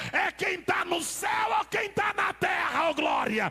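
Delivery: building from chanting to loud, high shouting; fervent chanted sermon climax; preaching at full force. Acted. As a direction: whip the crowd up with each count.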